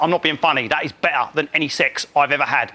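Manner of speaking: a hint of a blokey drool; mock Mark.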